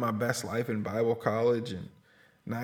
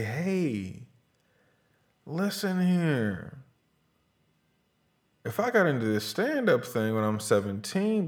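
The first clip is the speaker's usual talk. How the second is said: advice to younger self; 6 semitones higher than usual. Retold or imitated. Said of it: "I wish I had the wherewithal to say".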